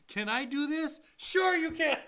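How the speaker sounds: excited self-answer. Retold or imitated. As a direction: pump it up like a pitch.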